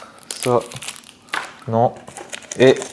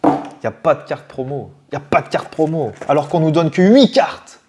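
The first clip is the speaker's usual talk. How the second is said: ordinary talk, nothing marked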